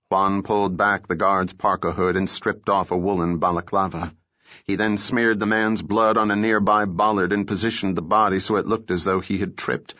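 ordinary delivery, nothing marked

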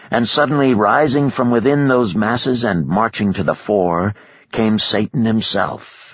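usual speaking voice

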